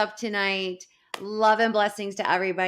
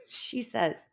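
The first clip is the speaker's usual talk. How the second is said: ordinary talk, nothing marked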